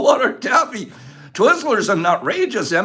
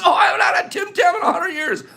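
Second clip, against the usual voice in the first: excited shout; 8 semitones higher, faster than usual. Real, not acted. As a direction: blurt it out excitedly. Excited about remembered candy.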